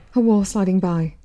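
ordinary delivery

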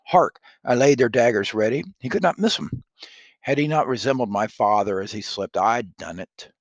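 loud, high, startled declaiming; dramatic Shakespeare reading. Acted. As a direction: jump at the noise, stage-style. Lady Macbeth startled.

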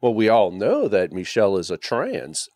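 low, slow and matter-of-fact. Retold or imitated. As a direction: state it as an obvious, known fact. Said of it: "it was so matter of fact".

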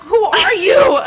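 high laughing yell of disbelief; laughing mock shout. Night desk clerk mock-shrieking at the drunk girl, laughing. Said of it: "I'm like".

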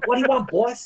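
high-pitched mobster's sidekick; put-on gangster flunky voice; mob henchman. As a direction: play the eager henchman to the boss. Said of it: "play one of the henchmen".

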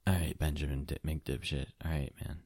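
low, very soft murmur to yourself; in-character self-talk. Acted. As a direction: start a hushed private pep talk. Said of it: "say that in character. Let me hear it".

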